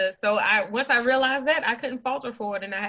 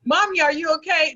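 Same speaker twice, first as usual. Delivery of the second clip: put-on a higher, louder child asking if mommy is okay; worried child voice; 9 semitones higher, 6 dB louder than usual. Kids asking their crying mother, worried child voice. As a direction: play her kids checking on their crying mom. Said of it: "My children was like".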